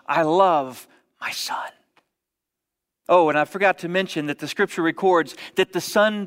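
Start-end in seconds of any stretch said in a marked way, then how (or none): 0.1-1.7 slow, tender whisper; hushed and slow. Acted. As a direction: whisper it like a secret of love. Father declares love, whispered.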